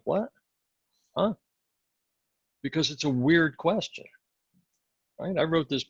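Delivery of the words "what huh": confused. Retold or imitated. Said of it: "There's a moment of".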